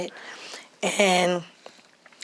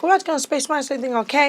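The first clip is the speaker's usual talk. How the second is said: worried question; 7 semitones higher, much faster than usual. Retold or imitated. Answering worried call.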